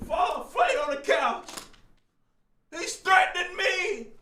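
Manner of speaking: high, faint, hammed-up distress; mock fainting victim. Streamer mocking his accuser on the couch, mock fainting victim. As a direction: swoon through it in a small high voice. Mimics swooning accuser's complaint.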